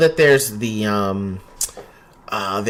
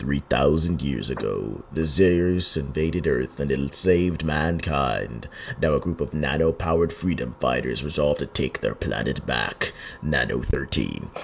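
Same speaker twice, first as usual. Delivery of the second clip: movie producer voice; 8 semitones lower, 4 dB quieter than usual. Shown as a demonstration. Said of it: "producer voice on as I read the description".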